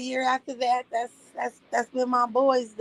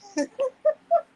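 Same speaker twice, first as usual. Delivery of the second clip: a high squealing laugh breaking out; giggling at her own story; high laughing cackle. Real, not acted. Laughing at own agony quote.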